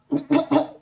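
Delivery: hacking cough; coughing fit. Retold or imitated. Choking on bong smoke, hacking cough. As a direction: cough hard after the huge hit. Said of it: "Coughing my ass up".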